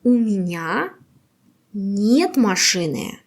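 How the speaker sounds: ordinary delivery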